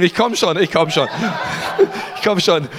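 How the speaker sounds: laughing while hurrying. Real, not acted. Preacher rushing to grab his Bible text, laughing. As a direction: chuckle through the words.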